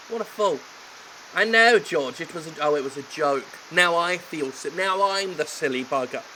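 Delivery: usual speaking voice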